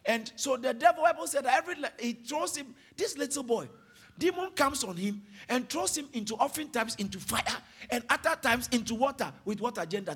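ordinary delivery

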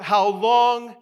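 high, very loud cry; calling out. Acted. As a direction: call it out as a martyr's plea. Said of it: "the martyrs calling out".

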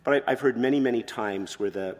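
normal talking voice

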